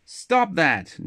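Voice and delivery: a lightly acted angry command; angry shout. Jack telling the man to stop hitting the dog, mild mock shout. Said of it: "shouted angrily".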